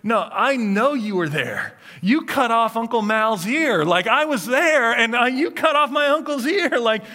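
loud, high, insistent accusation; accusing outburst. Acted. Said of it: "he finally speaks up and he goes".

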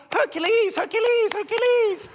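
high, loud over-the-top praise chant; gushing cheerleader. Retold or imitated. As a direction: gush like a doting mom. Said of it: "sitting there going".

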